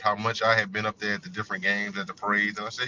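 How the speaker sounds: normal talking voice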